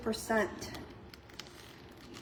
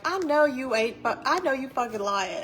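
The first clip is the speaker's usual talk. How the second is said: loud, accusing call-out; calling out a kid; 3 semitones higher, 7 dB louder than usual. Retold or imitated. Telling off a friend's kid for lying, loud. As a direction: catch the kid in a lie, loudly. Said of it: "I always tell my friends' kids".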